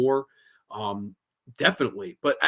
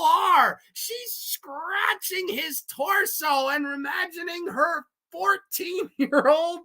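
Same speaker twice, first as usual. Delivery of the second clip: incredulous; 13 semitones higher, slower than usual. Real, not acted. Ranting at a weird movie scene.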